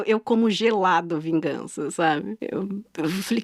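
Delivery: usual speaking voice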